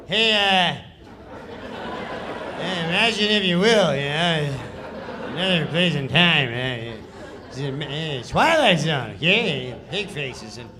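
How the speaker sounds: loud, laughing, mumbled throwaway read; Norm's lazy read. Retold or imitated. Comic imitates Norm's careless table read, laughing. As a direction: toss it off with a laugh, no effort. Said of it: "this is how he reads the sketch".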